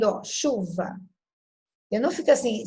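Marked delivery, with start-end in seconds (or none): 0.2-1.0 "chuva" begun with one long sh; pronunciation demo. Shown as a demonstration. Teacher showing a digraph to students, careful demonstration. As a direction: draw out "chuva", CH as a single sound. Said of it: "Tá vendo?".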